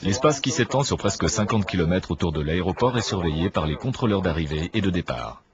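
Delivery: normal talking voice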